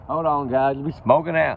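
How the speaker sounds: loud startled warning; alarmed call. Real, not acted. Man startled by fire smoke, loud alarmed call. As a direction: blurt it out as smoke hits.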